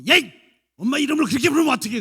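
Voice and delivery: loud, high, fast telling-off; mock scolding mother. 엄마가 아이를 혼내는 흉내. (엄마가 버럭 혼내는 말투)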